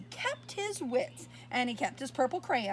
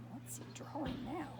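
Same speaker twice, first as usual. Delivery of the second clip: much softer, curious question; hushed aside; 11 dB quieter than usual. Real, not acted. Reader softly asking the child what Harold draws next.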